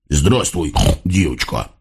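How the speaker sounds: low, oily devil greeting; lightly voiced low devil; sly hog devil. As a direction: lure her in, low and sly. Fat hog devil greets girl.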